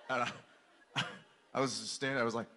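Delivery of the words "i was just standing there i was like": ordinary delivery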